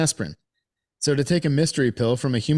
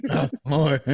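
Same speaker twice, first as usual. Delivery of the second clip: mimicked Marine grunt; 3 semitones higher than usual. Echoing friend's Marine impression.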